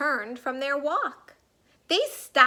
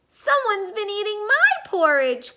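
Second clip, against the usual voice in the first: mama bear crying out; 7 semitones higher than usual. A narrator's reading. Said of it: "cried Mama Bear".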